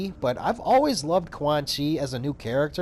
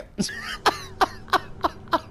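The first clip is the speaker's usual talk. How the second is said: breathless high laugh. Real, not acted. Laughing at own impression.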